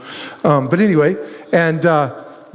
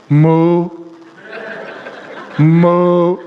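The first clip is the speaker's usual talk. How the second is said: cow moo. Pretend. Mocking the golden cow.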